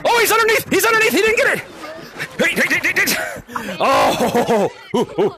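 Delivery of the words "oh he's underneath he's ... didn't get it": excited commentary. Real, not acted. Watching a player dodge the tag.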